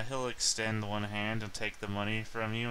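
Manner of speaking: ordinary talk, nothing marked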